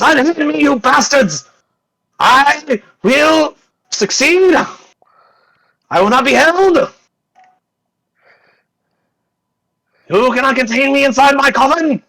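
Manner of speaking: high-pitched melodramatic villain protest; outraged shouting. Acted. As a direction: yell defiance while being dragged off. Dragged away, protesting loudly.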